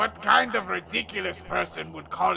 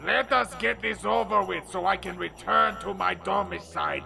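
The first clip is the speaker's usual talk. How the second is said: rushed; faster than usual. Acted. Hurrying to get it over.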